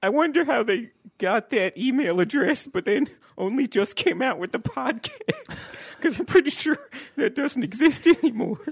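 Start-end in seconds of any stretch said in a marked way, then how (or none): 4.6-8.6 talking through laughter; laughing. Real, not acted. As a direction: let the laugh run through the words. Laughing at the rival's email.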